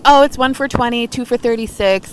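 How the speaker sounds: high, friendly sing-song clerk; super sweet. Retold or imitated. Clerk quoting prices to the couple, super sweet. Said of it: "like, super sweet".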